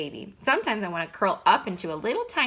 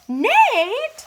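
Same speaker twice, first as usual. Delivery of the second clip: scolding; 7 dB louder than usual. Acted. Mom scolding dad.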